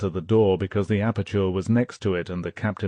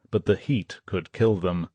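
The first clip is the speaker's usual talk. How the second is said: ordinary delivery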